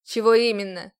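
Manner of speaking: loud, curt demand; booming voice. A narrator's reading. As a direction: thunder the question back. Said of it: "прогремел его голос".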